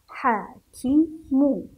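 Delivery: loud, slow "حاكم" split as haa, kee, mu; syllable by syllable. Shown as a demonstration. Teacher sounds out a word for pupils, loud separate syllables in "حاكم". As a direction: punch out each syllable of "حاكم".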